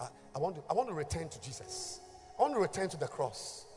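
usual speaking voice

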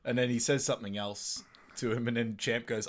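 usual speaking voice

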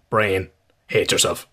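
usual speaking voice

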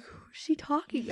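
hushed unvoiced whisper; whispered aside. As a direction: whisper it in disbelief. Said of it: "I was like".

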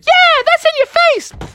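shrieky high victory cry; triumphant trash talk, voice shooting way up; gloating taunt. Acted. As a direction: crow over the touchdown. Touchdown taunt.

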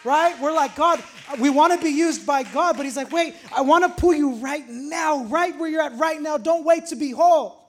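high, loud preaching climax; preaching at full force. Acted. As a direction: hit the peak of the sermon. Peak of the sermon.

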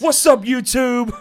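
high, loud, slow YouTuber greeting; mock YouTuber hello. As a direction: ham up a vlogger intro. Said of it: "I have a YouTube accent".

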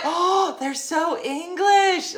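high, drawn-out gushing over how English they are; delighted exclamation. Retold or imitated. Vlogger retelling her reaction to the show, delighted squeal. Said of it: "I was like".

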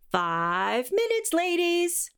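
drawn-out, sing-song stage call rising high; cheery high-ended cue call. Acted. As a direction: call the dancers to places, stretched out and lifting.

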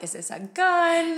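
sudden fear at what he pulls out; alarmed. Retold or imitated. Retelling a date reaching into the console, alarmed.

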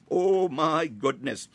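ordinary delivery

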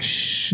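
soft, breath-only shush; drawn-out quiet "shh"; hushing shh. Acted. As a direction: hush someone mid-question. Acting out hushing her again.

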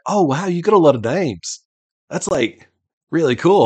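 lightly voiced Luffy, genuinely wowed; Luffy, impressed. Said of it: "Luffy is then like".

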